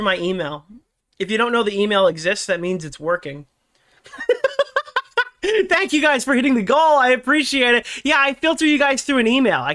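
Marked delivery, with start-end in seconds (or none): 4.1-5.6 bursts into a high laugh. Real, not acted. Laughs at own joke.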